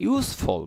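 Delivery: ইউজফুল said with a hissed s, not z; s in useful. Shown as a demonstration. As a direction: say ইউজফুল as "yoos-ful". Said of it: "মানে এখানে স". (ইউজফুল, জ-এর জায়গায় স ধ্বনি)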